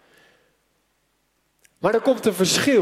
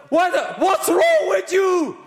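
shouting across the noise; 11 semitones higher, slower than usual. Retold or imitated. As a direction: yell it to someone who can't hear you. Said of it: "heen en weer te schreeuwen".

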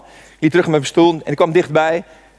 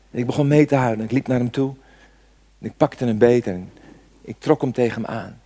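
normal talking voice

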